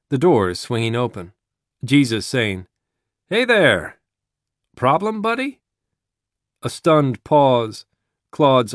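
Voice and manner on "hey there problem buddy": high, friendly call-out; bright interruption. A narrator's reading. Jesus walking in on a gunman, high friendly hail. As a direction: pipe up high, ending on a rising question.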